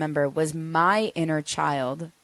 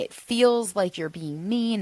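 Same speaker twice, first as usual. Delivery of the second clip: ordinary talk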